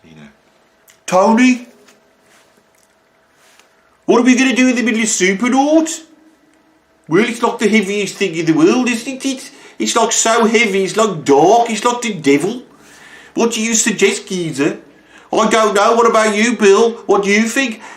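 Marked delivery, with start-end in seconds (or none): 1.0-14.8 much higher, put-on English regional accent, hammed up; mock band accent. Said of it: "What was that accent?". 15.3-17.8 much higher, put-on English regional accent, hammed up; mock band accent. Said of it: "What was that accent?".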